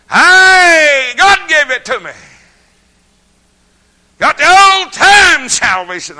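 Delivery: high, loud shout of praise; hollering out their salvation; old-time shout of joy. Retold or imitated. Church folks shouting they got salvation, loud and high. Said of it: "you could hear them shout".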